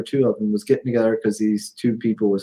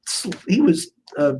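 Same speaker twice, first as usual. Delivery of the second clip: exasperated protest; 8 semitones higher, 5 dB louder than usual. Real, not acted. Exasperated rant about Hercules' portrayal.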